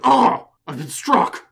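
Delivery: loud, high mock cry of pain; hammed-up wounded movie victim, drawn out; overacted punch reaction. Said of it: "they start reacting like".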